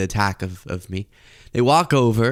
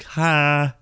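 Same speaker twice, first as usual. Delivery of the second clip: meek voice; 3 semitones higher than usual. Acted. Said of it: "I don't know why this is my voice".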